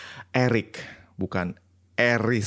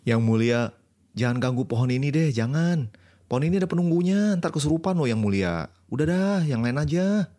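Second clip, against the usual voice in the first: pleading warning; 6 semitones higher than usual. Acted. Disguised goddess warns the king.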